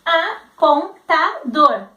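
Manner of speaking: "Apontador" said very slowly, syllable by syllable; slow sounding out. Shown as a demonstration. Teacher acting the child reading "Apontador" slowly. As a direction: stretch every syllable. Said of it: "E o O".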